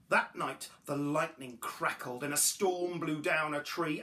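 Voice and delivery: ordinary delivery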